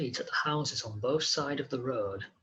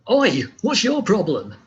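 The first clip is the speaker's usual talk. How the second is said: put-on loud, high, rough street-kid challenge; annoyed youth; 7 semitones higher, 9 dB louder than usual. Hooded youth shouts at Harvey, annoyed and loud. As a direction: bark it at the stranger staring at you.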